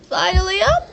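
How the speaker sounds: a hint of an old woman, laughing; half-hearted granny voice with a laugh; attempted grandmother voice. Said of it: "I don't know how to do her voice".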